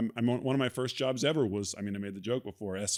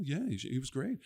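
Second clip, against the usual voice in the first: softer, higher offhand extra's answer; mock background diner reply; 4 semitones higher, 5 dB quieter than usual. Actor demoing loop group chatter, a second extra replying softly. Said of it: "be like".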